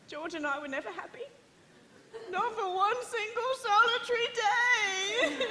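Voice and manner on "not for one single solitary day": laughing through tears, slow and higher; tearful. Acted. Sister weeping over her unhappy marriage, through tears. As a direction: voice crumbling into crying.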